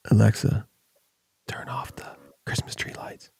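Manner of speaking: hushed, unvoiced whisper to a smart speaker. Shown as a demonstration. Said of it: "you sit and whisper to Siri".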